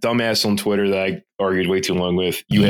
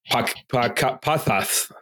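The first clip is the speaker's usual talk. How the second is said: Castilian Spanish lisp; 3 semitones higher, much slower than usual. Shown as a demonstration. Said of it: "that's like I'm going to Spain".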